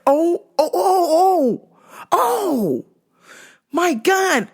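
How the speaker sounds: stunned laughing gasps at the yellow customer; shocked oh my God. Retold or imitated. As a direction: react as if you can't believe your eyes.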